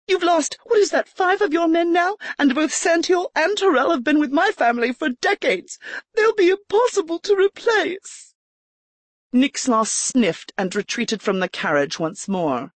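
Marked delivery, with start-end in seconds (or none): 0.1-8.3 much higher, indignant outburst; exclaiming. A narrator's reading. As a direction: blurt it out in indignation. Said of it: "Erica keeps up indignation".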